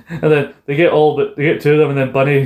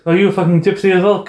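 mock outrage; 7 semitones higher than usual.

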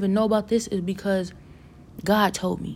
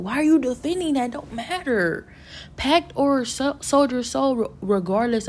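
exasperated challenge; 6 semitones higher, 4 dB louder than usual. Retold or imitated. Retold argument, challenging the others.

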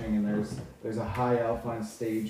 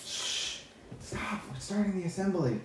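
higher, hushed shush and scolding; urgent hush in a higher voice; 9 semitones higher, 6 dB quieter than usual. Acted. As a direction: shush someone as the assembly starts. Student shushes a player.

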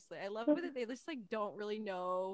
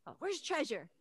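high, loud gushing fanfare; excited greeting; 9 semitones higher, 6 dB louder than usual. Retold or imitated. Kids greeting the life of the party, excitedly. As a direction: mimic kids thrilled to see their favorite.

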